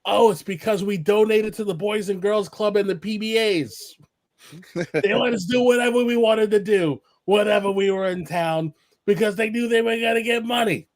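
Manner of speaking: put-on amused old wrestler. Sullivan laughing as he explains it to the interviewer, amused voice. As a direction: chuckle through the old story. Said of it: "he starts laughing and goes".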